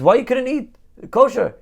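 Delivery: high-pitched comic challenge; incredulous rabbi. Acted. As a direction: demand the reason in mock disbelief. Rabbi demands an explanation.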